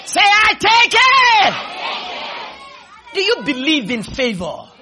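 high shouted challenge to the congregation; full-force preaching. Real, not acted. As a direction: rouse the crowd at full voice.

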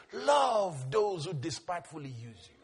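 normal talking voice

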